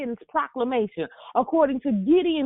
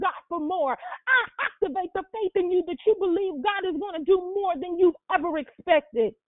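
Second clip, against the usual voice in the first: raised, driving sermon delivery; fervent prophetic preaching; 5 semitones higher than usual. Real, not acted. Preacher prophesies miracles to the congregation, fervent preaching. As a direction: declare it over them in insistent, building repetitions.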